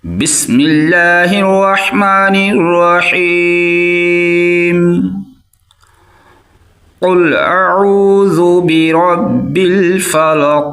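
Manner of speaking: slow melodic tajweed chant; quranic recitation. Shown as a demonstration. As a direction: recite slowly, drawing out each vowel. (धीमी सुरीली क़िरात)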